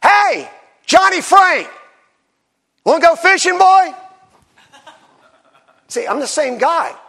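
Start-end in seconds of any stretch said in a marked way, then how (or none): 0.0-3.9 put-on high, loud, drawn-out holler to his boy; excited fatherly shout; dad calling out. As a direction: imitate an eager dad yelling an invitation. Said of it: "When my dad wanted to take us fishing".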